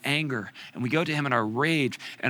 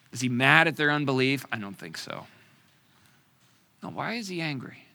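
ordinary talk

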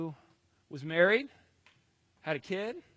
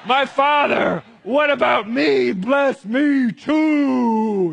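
high, drawn-out desperate wail; acted anguished plea, stretched out; loud begging; 9 semitones higher, 16 dB louder, much slower than usual. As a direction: beg at the top of your voice. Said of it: "he begged".

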